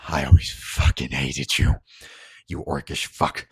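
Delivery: low, forceful curse; bitter hatred. Acted. Harlan curses Baraka at gunpoint, low bitter anger. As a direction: push out the curse low and hard. Dying man curses his executioner.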